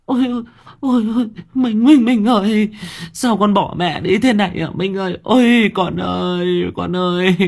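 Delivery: high, loud mother's keening; keen over the boy as if he were dead; wailing lament. A narrator's reading. Mother sees her son lying by the river, wailing. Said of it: "bù lu bù loa".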